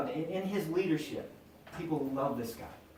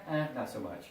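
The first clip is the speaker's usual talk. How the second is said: ordinary delivery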